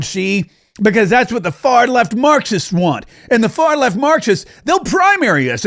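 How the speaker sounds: hammed-up old-time mobster, slurring the s into sh; scheming gangster voice from an old movie; fifties movie gangster. Host imitates politicians plotting their votes, fifties gangster voice. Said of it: "talking like a gangster from a, from a fifties, uh, movie".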